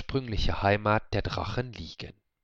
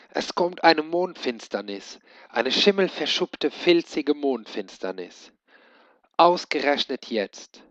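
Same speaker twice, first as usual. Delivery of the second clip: höher und lauter geschimpft; verzweifelt schimpfen; 5 semitones higher, 4 dB louder than usual. A narrator's reading. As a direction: die Mondfinsternis verfluchen. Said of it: "Verzweifelt blickte sie hinunter". (Koboldmädchen schimpft verzweifelt über die Mondfinsternis)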